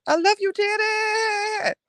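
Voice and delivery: stretched high fan cry, very slow; fan squeal. Pretend. Fan crying out devotion to Janet, high and slow. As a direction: sing out a long high I love you.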